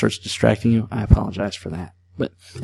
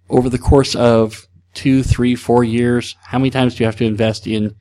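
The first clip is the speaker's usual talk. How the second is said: ordinary delivery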